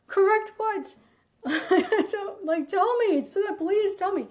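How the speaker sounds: high, pleading bewilderment with a laugh; confused pleading. Retold or imitated. Her pleading reply to boss.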